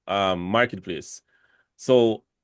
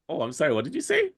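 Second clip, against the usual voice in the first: puzzled foreigner; 7 semitones higher, faster than usual. Retold or imitated. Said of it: "It's like".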